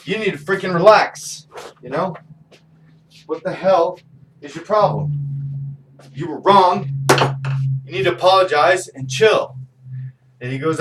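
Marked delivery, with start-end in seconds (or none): 0.1-9.6 loud parental rebuke; retold scolding. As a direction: tell him off to calm down. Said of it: "So I'm like".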